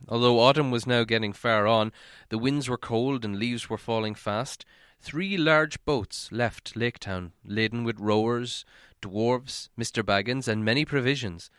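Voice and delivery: usual speaking voice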